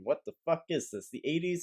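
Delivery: ordinary talk, nothing marked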